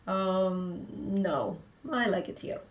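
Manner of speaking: usual speaking voice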